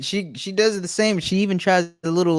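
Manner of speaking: normal talking voice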